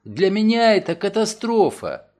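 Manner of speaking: Nancy protesting to Mason about her loss; indignant dismay. Acted. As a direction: make it sound like a catastrophe. Robbed woman protests.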